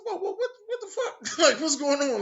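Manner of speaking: very high, loud, laughing through the shock; half laughing, half panicking; shocked laughing disbelief. Retold or imitated. Told school is letting out.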